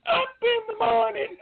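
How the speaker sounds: squeaky falsetto cadence call; mock high-pitched recruit calling cadence. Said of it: "I had a real high-pitched voice".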